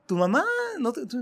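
usual speaking voice

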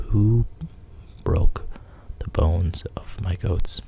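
ordinary delivery, nothing marked

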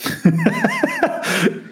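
high-pitched burst of laughter. Real, not acted. Reacts to "foda-se" profile.

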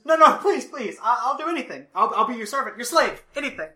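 high, frantic pleading; cornered man begging for his life. Acted. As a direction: beg for mercy, voice way up. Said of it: "he says".

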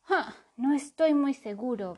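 unsure, faltering reply; hesitant. A narrator's reading. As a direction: hesitate over the answer. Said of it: "vaciló Ratón".

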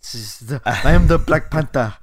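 put-on lightly done Wakanda accent, booming the hero's name; mock Wakanda accent. Mocking the actor's Wakanda accent for his co-host, lightly. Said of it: "was talking his Wakanda accent".

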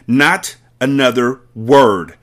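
slow loud command; shouted. Acted. Headmistress silencing Miss Honey, slow loud shout. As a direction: shut her up with each word. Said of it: "shouted Miss Trunchbull".

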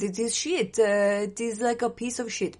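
lightly put-on French accent; jokey, bad French accent; a hint of mock French. Shown as a demonstration. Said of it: "That's my French accent".

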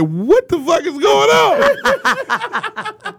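very slow, high, drawn-out amazement; stunned disbelief. Retold or imitated. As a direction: stretch it out like you can't believe your eyes. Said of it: "dude, we like".

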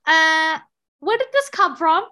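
high, loud baffled questioning; mock surprise. Elderly neighbor's warning about long-married partners, mock surprise. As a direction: act taken aback, pitch jumping up. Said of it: "You'll be surprised".

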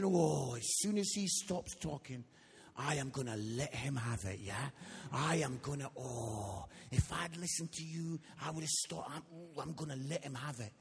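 low, simmering acted resentment; vengeful inner voice. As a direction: play someone privately relishing a comeback. Inwardly plotting revenge on the leader.

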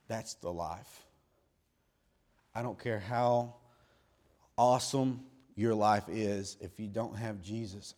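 ordinary delivery

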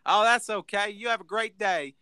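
high Southern woman voice; Southern lady explaining she forgot her wallet; Southern accent. Retold or imitated. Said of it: "she's got a thick, like, Southern accent".

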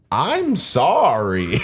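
very slow, stretched-out apology; drawn-out apology. Retold or imitated. Storyteller replying to the man's plea, drawn-out. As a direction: stretch both words right out.

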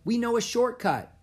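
put-on high, loud, eager child; excited small kid's voice; little girl calling. A narrator's reading. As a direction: offer help like a keen six-year-old. Small girl offering help.

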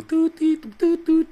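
high, loud bleep-like toots; censor bleep toots. Pretend. Bleeping over his own curse word, high toots.

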